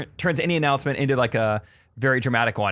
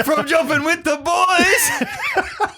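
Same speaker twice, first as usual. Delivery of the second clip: high, loud, laughing at the end; hammed-up breathless excitement; excited and breathless; 13 semitones higher, 4 dB louder, slower than usual. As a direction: gush about jumping with the boys. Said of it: "You got so excited about talking about jumping".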